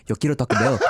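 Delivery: a light mock Taco Bell dog voice, laughing; lightly voiced cartoon Chihuahua ad line. Cohost joking the dog spoke, a light mock dog voice. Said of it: "and the Chihuahua went".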